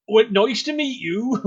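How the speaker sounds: high, slow farmer character. Acted. Player jokes about Dave being another farmer, high farmer voice. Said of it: "Please, another farmer".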